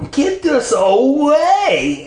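high, drawn-out whiny demand; slow, high-pitched insistence. A narrator's reading. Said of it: "Anne demanded".

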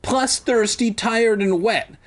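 high-pitched piled-on complaint; hammed-up pitiful griping; mock whining. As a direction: whine through a list of miseries. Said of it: "like a".

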